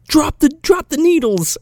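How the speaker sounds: mock panicked command. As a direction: play a flustered cop giving orders. Acts cop ordering knitter.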